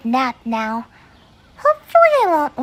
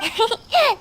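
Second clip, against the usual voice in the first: light giggle; 5 semitones higher than usual. Real, not acted. [name] laughs off the question.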